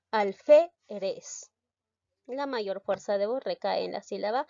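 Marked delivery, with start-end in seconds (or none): 0.1-1.5 alférez broken up, with FÉ punched loud; teacher's demo of alférez, stress on FÉ; stressed syllable demonstration. Shown as a demonstration. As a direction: pronounce alférez slowly, hitting the middle syllable FÉ. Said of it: "tenemos que pronunciar".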